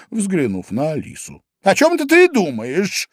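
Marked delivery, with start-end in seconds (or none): 1.6-3.1 high, loud scolding question; stern mouse. A narrator's reading. Mouse catches Alice not listening, stern and high. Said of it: "вдруг строго сказала мышь".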